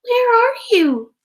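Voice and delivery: high, loud, squeaky cry for mother; a lost baby bat squeaking out for its mother; frightened squeak. Acted. Baby bat lost in the forest calls mother, high squeak. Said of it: "she squeaked".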